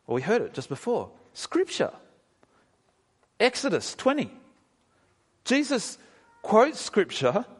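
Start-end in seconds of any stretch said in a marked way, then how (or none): 1.3-7.5 emphatic high lift. Real, not acted. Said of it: "emphatic point about Scripture".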